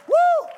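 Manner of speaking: high, loud joyful woo; excited whoop. Real, not acted. Preacher whooping at a sleepy church, loud and high. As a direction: let out a shout of joy.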